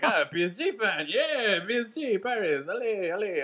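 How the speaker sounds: high, excited mock French fan; hammed-up French accent, high and cheering; bad French accent. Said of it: "trying to do a French accent".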